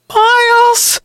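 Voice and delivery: very high-pitched call for help. A narrator's reading. Sister pleading with her unmoving brother above, calling out. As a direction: call up to him, high and urgent. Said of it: "she called out again".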